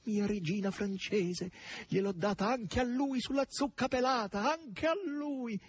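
high, slow, gleeful squawking; giddy high squawk; cackling glee. A narrator's reading. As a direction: crow it out delighted. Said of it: "con i suoi schiamazzi".

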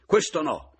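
a high, loud sharp no; snapping outburst. A narrator's reading. The mother-in-law, leaping up, snapping at Mattia. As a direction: jump up and bark it. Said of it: "scattò la pescatore balzando in piedi".

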